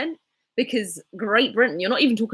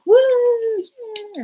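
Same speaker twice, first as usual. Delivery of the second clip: pretend wedding cheer; 4 dB louder than usual. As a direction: cheer in a small, high voice, half-hearted. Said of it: "I'm gonna be like".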